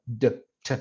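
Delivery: "d" and "t" as short isolated bursts of air; quick plosive release on "d" and "t"; brief stop sounds. Shown as a demonstration. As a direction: pop out "d", then "t", each very brief. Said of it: "Oclusiva es como breve".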